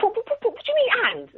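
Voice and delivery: very high, fast stammering mock indignation; flustered teacher. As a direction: mimic a taken-aback teacher, high-pitched and tripping over words. Retold teacher, taken aback.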